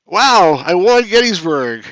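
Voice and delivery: real surprise at an unexpected win; amazed. Real, not acted. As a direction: exclaim it, pleasantly stunned.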